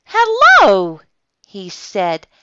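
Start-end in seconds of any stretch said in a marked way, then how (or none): 0.0-1.0 very high, loud, drawn-out greeting; surprised hello. A narrator's reading. Watchman spots the bear in the bed, surprised greeting. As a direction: swoop down from a high, loud start.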